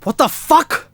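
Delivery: high, exasperated curse; angry tone. Acted. Boyfriend cursing at girlfriend, exasperated. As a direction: swear in disbelief, pitch jumping up. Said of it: "Don't use that kind of tone".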